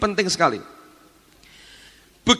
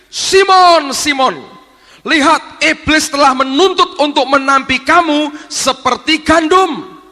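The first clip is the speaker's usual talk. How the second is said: raised, urgent call of a name; loud, pleading warning; 7 semitones higher, 6 dB louder than usual. Acted. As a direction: cry out to warn him. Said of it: "tiba-tiba Yesusnya teriak".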